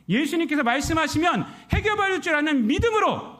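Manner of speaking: high, loud, driving preaching; fervent preaching. Acted. Preacher urging the congregation, fervent rising preaching.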